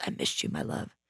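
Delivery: hushed, almost whispered imitation; tender retold murmur. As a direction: drop low and very soft, lovingly. Retold tender reunion with a hug.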